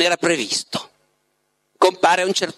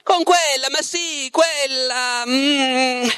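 high, drawn-out search for a word; groping for a name; 9 semitones higher, much slower than usual. A narrator's reading. The Master tries to recall her name, groping. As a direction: trail into a hum as the name escapes you. Guest gropes for a forgotten name.

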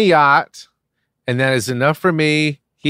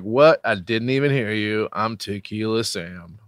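put-on oblivious drunk; 5 semitones lower, 5 dB quieter than usual. Drunk guest not hearing the deal, low and soft. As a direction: lower and much softer, out-of-it drunk.

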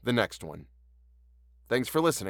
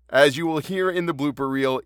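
ordinary delivery

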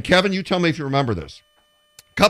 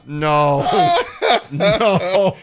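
laughing through mock horror, rising; half-laughing, mortified pleas; 4 semitones higher, much slower than usual. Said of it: "I was like".

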